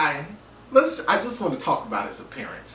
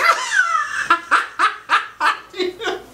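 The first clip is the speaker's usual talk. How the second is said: high squealing burst of laughter; real helpless laugh; shrieking laugh that climbs; big laugh; 3 dB louder than usual. Real, not acted. Laughing at the awful picture.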